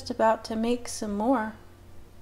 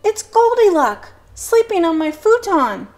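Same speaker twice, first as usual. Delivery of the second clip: excited; 10 semitones higher, 8 dB louder than usual. Acted. Boy discovering the intruder.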